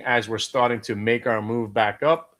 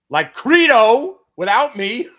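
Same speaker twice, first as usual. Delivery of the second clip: loud, slow, outraged cry; excited shout; 9 semitones higher, 10 dB louder, slower than usual. Real, not acted. Trader to viewers, a loud slow cry. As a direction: belt it out as the stock takes off.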